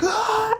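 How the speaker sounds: high, sharp attacking yell; battle yell. Acted. Maui charging at Abaddon, battle yell.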